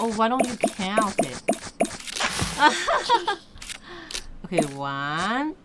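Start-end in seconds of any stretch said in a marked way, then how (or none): 1.5-4.4 long, high-pitched laugh; extended peal of laughter. Real, not acted. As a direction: burst into helpless giggles. Parent amused by falling items.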